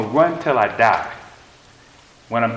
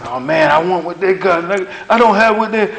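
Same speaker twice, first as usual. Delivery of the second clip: envious inner voice; 5 semitones higher than usual. Retold or imitated. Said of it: "I get envious".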